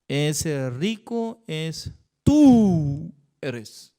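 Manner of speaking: very slow, stress on tú; slow accusation. Acted. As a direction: point the finger, land hard on tú. Prophet accuses the king.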